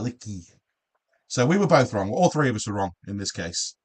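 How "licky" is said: "Licky" repeated with short i, ending in ee; pronunciation demo. Shown as a demonstration. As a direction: model "Licky" once more for the listener. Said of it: "like that".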